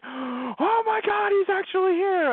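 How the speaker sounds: flipping out. Retold or imitated. Telling a friend about the Scotsman's return, squealing high. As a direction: freak out with delight, stretched slow. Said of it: "I start flipping out".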